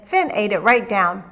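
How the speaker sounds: usual speaking voice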